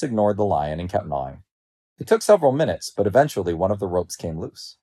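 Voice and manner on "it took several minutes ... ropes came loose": ordinary delivery, nothing marked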